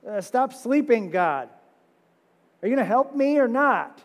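high, pushy mock complaint; impatient voiced plea; exasperated demand. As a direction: nag God to get up. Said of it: "demanding God wake".

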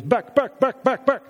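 loud repeated "back", pitch high; schoolmaster calling. Retold or imitated. Pastor imitating the schoolmaster driving the children, loud. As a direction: bark out "back" to move the kids along. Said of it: "and he goes".

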